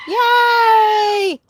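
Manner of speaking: high, loud cheer; cheering with livestream viewers, gleeful shout; cheering yay. Real, not acted. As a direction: whoop it out with glee. Cheering along with chat.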